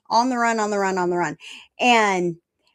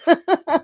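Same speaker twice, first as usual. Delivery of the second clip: high, loud amused laugh; short, hearty cackle; real laugh; 7 semitones higher, 5 dB louder than usual. Real, not acted. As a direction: burst out laughing at your own joke. Laughs at her own comeback.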